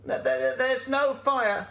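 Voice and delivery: high stammering goat voice; Gladys the goat. Acted. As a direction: correct her with a bleating stutter. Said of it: "said Gladys the goat".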